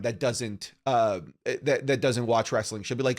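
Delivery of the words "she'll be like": normal talking voice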